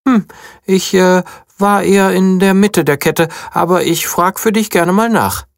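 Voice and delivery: high small bunny; little bunny voice. A narrator's reading. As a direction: speak like a proud little guard bunny. Bunny hesitant reply.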